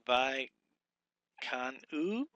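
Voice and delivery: ordinary delivery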